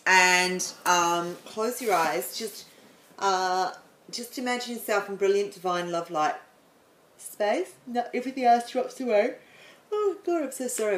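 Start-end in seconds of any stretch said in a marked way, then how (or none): 1.4-9.3 calm, gentle meditation voice; guided meditation. Acted. Meditation teacher guiding her listeners into relaxation. 9.9-10.4 a real yawn slipping into the words; words stretched by a yawn. Real, not acted. Meditation teacher yawning mid-session, apologising. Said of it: "I'm yawning".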